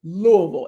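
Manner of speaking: Louisville rolled together as LOO-uh-vul; Louisville squeezed to LOO-uh-vul; local pronunciation. Shown as a demonstration. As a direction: say Louisville as one rolled word. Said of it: "you gotta roll your tongue".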